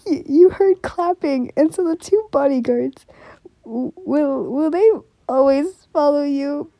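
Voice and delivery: trying to recover from the giggles; flustered. Real, not acted. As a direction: carry on reading while still flustered. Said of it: "I'm just really flustered about that".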